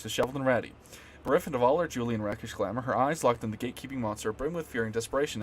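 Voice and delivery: ordinary talk, nothing marked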